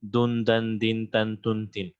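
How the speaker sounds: دن، دن، دن، تن، تن، تن chanted as nasal endings un, in, an; tanween sound demo. Shown as a demonstration. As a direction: sound out the nunation endings slowly. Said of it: "یہ کون سا sound ہے؟".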